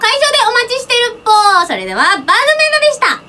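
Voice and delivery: high sing-song goodbye; cute maid sign-off. Acted. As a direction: chirp the farewell sweetly.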